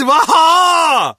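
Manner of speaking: a big loud howl of despair; hammed-up cry of anguish; loud anguished wail. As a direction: wail out loud in mock grief. Guard cries over punishment.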